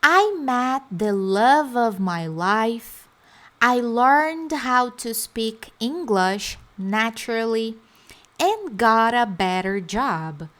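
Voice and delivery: each phrase spoken slowly and clearly for repetition; calm teacher model pace; slow deliberate drill; slow model sentences. Shown as a demonstration. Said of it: "Olha o tanto de sonho realizado".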